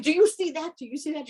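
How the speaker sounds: high, excited retold yell; excited retold scream. Telling a friend she sees a message on the rocks, high and excited. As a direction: squeal with excitement, half-laughing. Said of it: "And I screamed".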